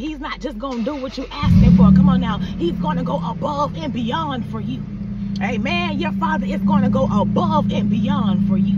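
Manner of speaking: loud, climbing preacher's call over the music; preaching at full force. Acted. Online preacher rallying her followers, loud and rising. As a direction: lift the congregation, push it out.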